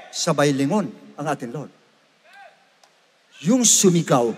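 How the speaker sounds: normal talking voice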